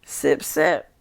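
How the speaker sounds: slow, exaggerated not-me act; hammed-up nonchalant aside; imitating smug sipper. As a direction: play it up, loud and drawn out. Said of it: "Gina was like".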